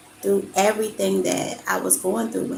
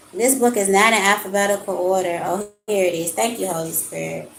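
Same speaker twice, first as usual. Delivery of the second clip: ordinary delivery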